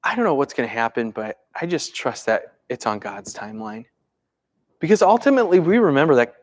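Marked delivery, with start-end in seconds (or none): 0.0-3.9 low, soft acceptance; calm trust. Acted. Believer accepting God's timeline, calmly trusting. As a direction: let the worry go quietly. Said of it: "how often do we just simply go".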